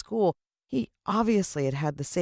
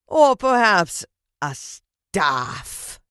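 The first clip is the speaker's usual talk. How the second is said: louder, slow, triumphant villain proclamation; grand villain address; 8 dB louder, much slower than usual. Acted. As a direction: spread your arms and own the crowd. Villain voice held.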